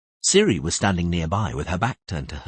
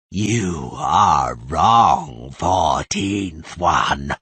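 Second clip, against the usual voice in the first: slow, low, menacing mockery; cold mocking voice; 4 semitones lower, 4 dB louder, much slower than usual. The possessing voice mocking Tris, cold and hostile. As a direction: threaten her with cruel scorn. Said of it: "the metallic, inhuman voice sneered".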